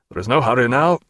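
ordinary delivery

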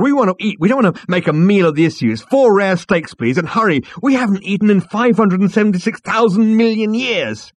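high, loud, urgent ordering; impatient demand. Acted. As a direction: demand food as if starving. Zaphod impatiently demands food.

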